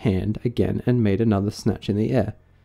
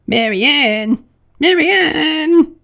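high, loud rabbit calling for the maid; 7 dB louder, much slower than usual. Acted. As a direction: summon her sharply, stretching the name.

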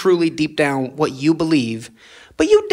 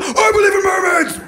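indignant believer; 13 semitones higher than usual. Retold or imitated. Believer defending mermaid faith to the mother, high indignant shouting. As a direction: shout your faith like it's under attack. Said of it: "What is wrong with".

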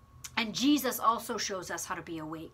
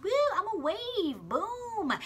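much higher, bouncy cartoon voice; carefree wave; 10 semitones higher than usual. The wave in the story before it sees the shore, much higher playful voice. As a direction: play the wave having fun. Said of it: "and it's like".

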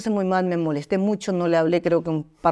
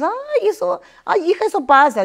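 lightly voiced dismissive older women, pitched higher; mocking other people; 10 semitones higher, 3 dB louder than usual. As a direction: brush it off like a know-it-all auntie, a bit higher. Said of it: "que otras personas".

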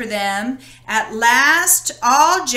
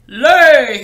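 much louder barked command; roar; 2 semitones higher, 11 dB louder than usual. Acted. Giant orders his magic hen, loud roar. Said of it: "he roared".

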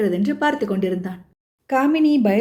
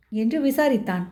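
usual speaking voice

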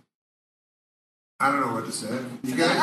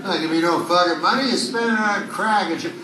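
mock rant; 6 semitones higher than usual. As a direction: rail at him in a hammed-up tirade. Ranting at the homeless guy.

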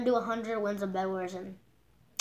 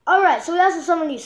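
loud, fast and higher; 5 semitones higher, 7 dB louder, faster than usual. Shown as a demonstration. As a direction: burst out loud and quick. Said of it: "Summer news today".

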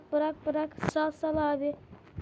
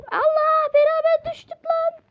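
high loud panicked yell; loud high scream; panicked shout; 10 semitones higher, 8 dB louder than usual. Real, not acted. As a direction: yell as you fall. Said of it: "sen ... daha çok seslisin".

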